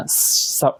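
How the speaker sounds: স as an unvoiced hiss; snake-like S. Shown as a demonstration. Teacher likening S to a snake, স as a hiss. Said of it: "ওই যে snake যেরকম".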